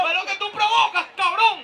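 reproche más alto y fuerte, con risa; enojo fingido. Acted. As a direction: reclamo en broma con risa. Pelea actuada en escena. (enojo de broma entre compañeros)

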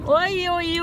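ordinary delivery

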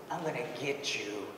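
very soft, low acted threat; hushed menace. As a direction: drop right down, imitating a possessed man's threat. Acting possessed man's threat to mother.

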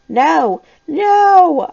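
high, loud drawn-out moan; frightened moan. A narrator's reading. Corey in the dark after a scream, moaning. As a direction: wail it out, rising in pitch and volume. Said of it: "I heard Corey Schuyler moan".

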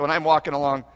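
ordinary talk, nothing marked

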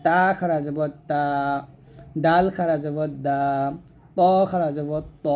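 tongue-on-teeth "তা", "দা", "ত", each final vowel held long; tongue-on-teeth articulation. Shown as a demonstration. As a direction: spell it, then stretch "তা", "দা", "ত". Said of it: "জিহ্বাটা নিচে দাঁতের মাঝে লাগিয়ে উচ্চারণ".

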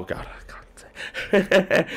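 whispered, slow; embarrassed whisper. Real, not acted. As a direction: hush it like you said too much. Catches himself mid-remark.

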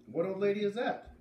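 put-on high, bright girl calling out; high-pitched girl calling across the yard; voiced farm girl calling. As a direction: call out as a friendly farm girl. Beat asks.

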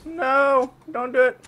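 squeaky, loud high voice; silly high voice. Pretend. Gamer begging teammate not to act, high squeak. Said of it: "I gotta stop doing that voice".

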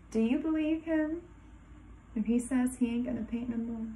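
ordinary delivery, nothing marked

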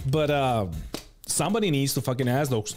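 ordinary talk

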